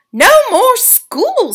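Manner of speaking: high, slow, gleeful; grinning mouse-boy. A narrator's reading. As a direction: savor it with a grin. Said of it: "grinning a broad".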